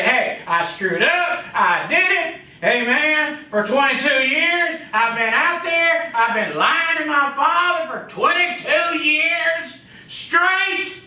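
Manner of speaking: high, drawn-out preacher's confession; emphatic confession. Acted. Sinner owning up to lying to his father, emphatic preached confession.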